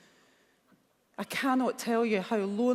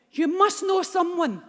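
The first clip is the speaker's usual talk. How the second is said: higher and louder, pressing hard; urgent insisting; 8 semitones higher, 6 dB louder than usual. Retold or imitated. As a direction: demand an answer, shaking her. Mum shaking her, insisting.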